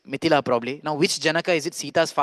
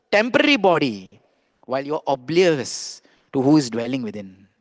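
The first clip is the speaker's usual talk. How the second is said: sage's stern rebuke; 4 dB louder than usual. Acted. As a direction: play the sage chiding the assembly forcefully. Acted sage rebukes the assembly.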